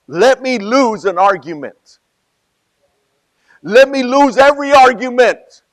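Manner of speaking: rising from loud to a full high shout; building preaching shout. Real, not acted. Preacher building to a shout before the congregation. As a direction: build it up until you're shouting.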